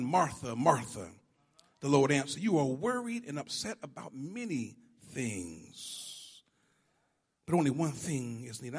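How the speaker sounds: calm her down softly; gentle Jesus. Acted. Jesus gently answers worried Martha, soft and tender.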